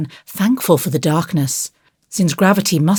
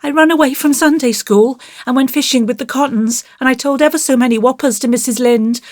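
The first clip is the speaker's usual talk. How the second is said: put-on high, breathless-rush little-boy confession; guilty child tumbling it out, pitched high; 9 semitones higher than usual. A narrator's reading. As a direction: blurt it all out like a guilty child. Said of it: "Out it all came in a rush".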